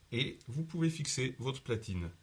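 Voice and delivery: ordinary talk, nothing marked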